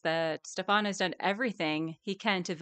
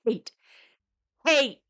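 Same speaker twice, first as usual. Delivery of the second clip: louder, higher single word; spat hate; 5 semitones higher, 7 dB louder than usual. Real, not acted. Stressing her hatred of Stefan, spat out. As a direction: bite the word off hard.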